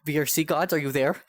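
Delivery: usual speaking voice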